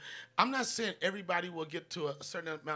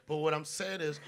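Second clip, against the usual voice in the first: usual speaking voice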